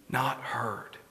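breathy grave whisper. Acted. Preacher whispering the refrain to the congregation. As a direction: let the words hang in a whisper.

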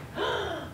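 soft high gasp of wonder; a hushed gasp; awed gasp. Retold or imitated. Children seeing a glow stick for the first time, soft awed gasp. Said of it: "and they're like".

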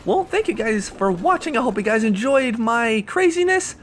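high, animated outro; upbeat sign-off. Real, not acted. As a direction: thank viewers with high energy. Video sign-off to viewers.

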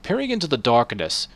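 usual speaking voice

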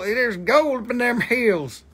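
high, loud, slow prospector cry; mock old prospector. Seller announcing gold to viewers, mock old prospector. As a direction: holler it like a gold-rush prospector.

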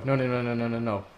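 ordinary delivery

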